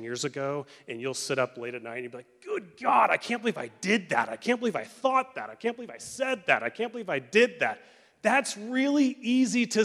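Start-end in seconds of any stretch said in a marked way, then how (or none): 2.4-7.8 loud, high cries of disbelief at yourself; acted-out shame spiral, louder and higher; mortified self-reproach. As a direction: berate yourself in appalled disbelief. Late-night shame spiral replayed.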